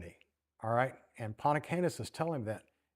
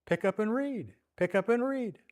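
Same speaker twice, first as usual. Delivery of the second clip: put-on high, lilting child's chant; child's sing-song voice; 8 semitones higher than usual. Child calling to Augustine in the garden, high sing-song. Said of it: "kind of in a sing-song voice".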